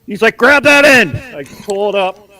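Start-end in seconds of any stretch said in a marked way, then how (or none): 0.4-1.2 mock shout, pitched up high; loud, high retold yell; dad calling out. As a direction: holler it like dad from the basement. Dad calling up to helper in story.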